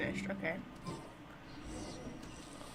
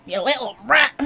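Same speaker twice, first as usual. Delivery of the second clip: loud teasing; 11 dB louder than usual. Real, not acted. Teasing the character again.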